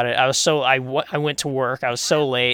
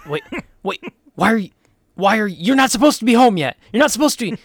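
high, fast, flustered mock homeowner; a homeowner stumbling on an intruder, caught off guard; startled homeowner; 7 semitones higher, 3 dB louder, much faster than usual. Family catches trampoline man.

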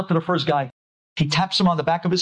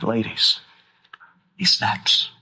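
ordinary talk

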